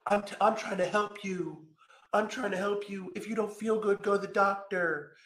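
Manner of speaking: high, soft whiny mimicry of her; mocking imitation. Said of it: "now she's gonna be like".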